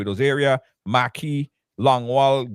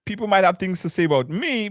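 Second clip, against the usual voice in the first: usual speaking voice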